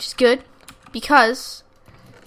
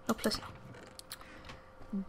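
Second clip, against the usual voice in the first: breathless whisper; 19 dB quieter, much slower than usual. Real, not acted. Tense moment, enemy closing in.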